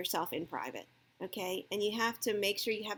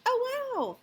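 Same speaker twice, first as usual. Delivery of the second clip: much higher, bright oh wow; delighted surprise; 14 semitones higher than usual. Shown as a demonstration. Parent praising best grade, delighted surprise. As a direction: gasp as if opening a Christmas present. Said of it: "pretend it's like Christmas morning".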